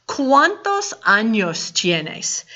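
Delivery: slow, clear Spanish; careful teacher model; slow model question. Shown as a demonstration. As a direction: lay it out slowly for learners. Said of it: "We have a new question word".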